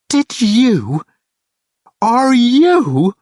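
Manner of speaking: high, loud sputtering questions; furious disbelief. A narrator's reading. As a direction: spit it out, too angry for sense. Kate furious at Thor.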